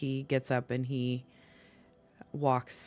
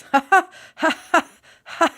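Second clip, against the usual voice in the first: high, loud nervous ha-ha-ha; nervous laugh; 14 semitones higher, 12 dB louder than usual. Acted. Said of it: "Talivar just kind of almost nervous".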